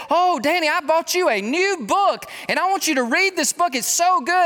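high, loud, gushing relative; high excited mock in-law voice; gushing sister-in-law. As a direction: imitate an eager sister-in-law pushing a gift.

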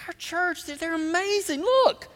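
high-pitched, proud gushing; proud bragging. Retold or imitated. Pastor boasting about the church to others, proud and excited. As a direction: show them off with excited pride. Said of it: "I just get so proud of you".

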